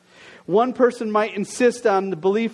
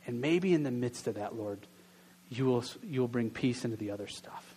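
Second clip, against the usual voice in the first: soft, hushed opening prayer, slowing down; quiet prayer; 7 semitones lower, 6 dB quieter than usual. Acted. Pastor leads the congregation in prayer, softly hushed. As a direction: pray gently, letting the voice sink low and soft. Pastor prays before the sermon.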